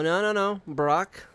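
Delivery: ordinary delivery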